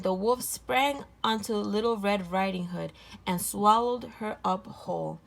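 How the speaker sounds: plain reading voice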